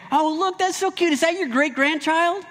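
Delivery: very high, gushing mock coo; cooing passerby. Passerby admiring an old woman's baby, high gushing coo. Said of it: "They're gonna say".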